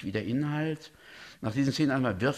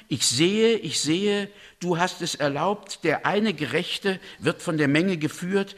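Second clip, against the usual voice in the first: raised, declaiming reading of a prophet's vision; visionary recital; 3 semitones higher, 3 dB louder than usual. Acted. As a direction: proclaim the vision like a seer, building. Reads Elihu's vision. (Elihu verkündet seine Vision, pathetisch vorgetragen)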